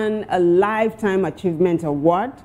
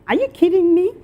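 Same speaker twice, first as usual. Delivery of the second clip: incredulous; 12 semitones higher, 5 dB louder than usual. Real, not acted. Disbelief at a netizen's comment.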